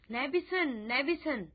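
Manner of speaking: ordinary delivery, nothing marked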